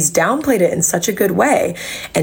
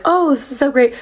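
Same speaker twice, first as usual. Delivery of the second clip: higher-pitched, gushing recalled delight; acted delight; 8 semitones higher than usual. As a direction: voice your earlier naive enthusiasm, pitch lifted. Recalling her first naive delight.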